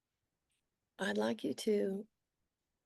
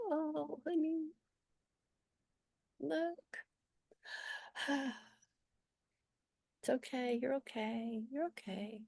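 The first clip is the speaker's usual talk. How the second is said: soft, higher-pitched tender comforting voice; gentle soothing; 6 semitones higher, 6 dB quieter than usual. Acted. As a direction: soothe like a parent with a scared child, sighing softly. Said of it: "like the gentleness that you never got".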